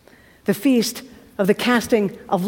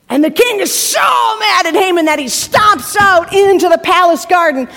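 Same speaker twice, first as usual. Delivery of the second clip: normal talking voice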